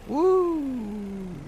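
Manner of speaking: high drawn-out "woo"; long falsetto woo; whoop. Real, not acted. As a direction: let out a high, sustained whoop. Cheering to self at start.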